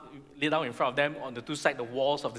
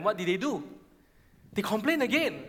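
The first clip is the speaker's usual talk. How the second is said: normal talking voice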